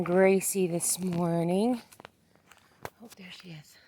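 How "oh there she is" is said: soft unvoiced whisper. Real, not acted. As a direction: whisper so she isn't startled. Whispered on finding the animal.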